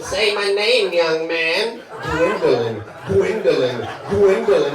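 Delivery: loud, hammed-up lover's chant; mock bedroom talk. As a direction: chant the name in rising mock passion. Acts out absurd bedroom talk.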